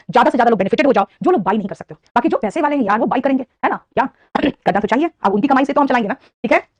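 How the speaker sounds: ordinary talk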